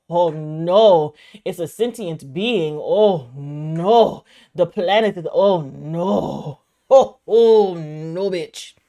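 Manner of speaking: loud, drawn-out wail of "oh no"; dramatic dismay. Retold or imitated. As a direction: build to a hammed-up, horrified realization. Retelling own horrified realization.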